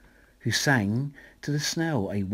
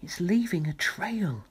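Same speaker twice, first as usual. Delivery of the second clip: put-on much higher childlike voice; children excited by the trail; children's voice; 8 semitones higher than usual. A narrator's reading. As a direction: marvel like a kid. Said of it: "say the children".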